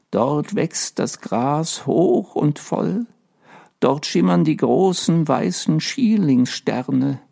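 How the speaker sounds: slow, faraway dreaming ghost; dreamy ghost. A narrator's reading. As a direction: paint the garden like a dream. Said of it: "mit träumerischer Stimme".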